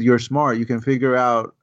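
ordinary delivery

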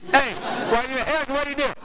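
high, mocking sing-song teasing; teasing friend. Friend teasing a fuming pastor before church, high mock sing-song. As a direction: needle your angry buddy, grinning. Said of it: "and he goes".

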